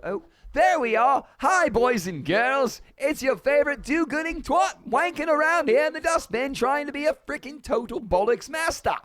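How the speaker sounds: high, loud, hammed-up British-Australian accent. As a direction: greet the boys and girls in a mixed British-Australian accent. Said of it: "the cross between British and Australian".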